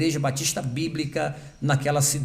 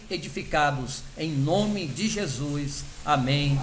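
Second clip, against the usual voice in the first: prayer; 3 semitones higher than usual. Real, not acted. Leading the church in prayer before the guest preaches, earnest prayer. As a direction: pray aloud for the pastors and the evening.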